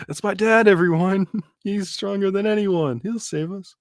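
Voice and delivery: high mock boy voice breaking into laughs; adoring son. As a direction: brag about dad in a silly high voice, amused. Mocking Colin's faith, laughing.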